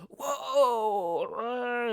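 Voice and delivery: long, high mock crowd roar; crowd screaming. Everybody screaming at the band, drawn-out high whoa. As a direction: imitate a whole bar cheering wildly. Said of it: "Everybody's screaming".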